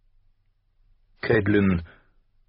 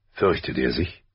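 lowered voice of the father; father's voice; 5 semitones lower than usual. A narrator's reading. Husband asks about his youngest son, low father's voice. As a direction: drop the pitch for the husband's question.

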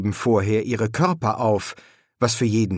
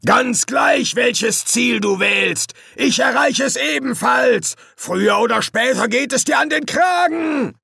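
high raging demon threats; furious shout; 13 semitones higher, 4 dB louder than usual. A narrator's reading. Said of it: "Hasturs wütende Stimme".

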